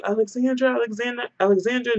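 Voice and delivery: high-pitched, held-back calling out of a name; mock yelling. Friends searching downtown for the missing woman, mock yelling. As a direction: imitate yelling for someone without the volume. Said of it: "yelling her name, yelling".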